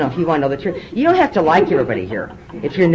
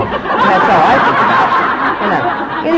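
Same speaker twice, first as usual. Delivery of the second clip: breathy laugh-speech, louder and slower; laughing through it; 5 semitones higher, 6 dB louder, much slower than usual. Real, not acted. Laughing to the audience about California. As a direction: let the words ride the laugh. Laughing at her own fixation.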